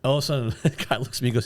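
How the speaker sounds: ordinary delivery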